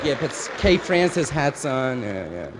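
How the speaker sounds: ordinary talk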